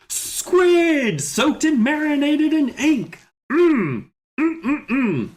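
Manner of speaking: very high, slow, relishing character voice; drawn-out high cartoonish voice savoring the dish; Alex. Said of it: "What's the voice we gave him?".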